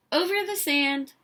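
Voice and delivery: ordinary delivery, nothing marked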